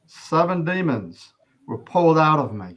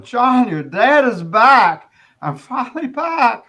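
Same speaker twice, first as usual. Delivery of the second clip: higher, louder and slow, as if sobbing to his son; retold bawling; 8 semitones higher, 7 dB louder than usual. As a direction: choke it out through tears of relief. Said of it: "he and I were just crying".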